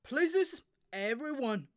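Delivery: loud, high stage call; director calling out. Acted. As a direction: summon the whole cast. Director calls cast to places.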